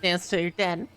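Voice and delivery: ordinary talk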